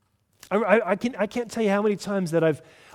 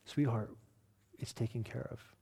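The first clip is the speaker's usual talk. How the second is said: softer, calm and kind; wife gently settling her frustrated husband; gentle reassurance; 5 semitones lower, 11 dB quieter than usual. Retold or imitated. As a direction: say it softly to calm a stressed husband. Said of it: "just say something just so simple".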